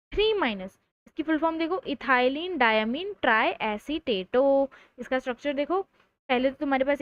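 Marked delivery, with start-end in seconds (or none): 1.8-4.7 slow spelled-out name. Shown as a demonstration. Teacher spelling out full name.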